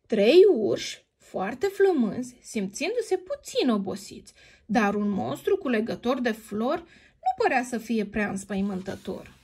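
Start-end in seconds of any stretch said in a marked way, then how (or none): none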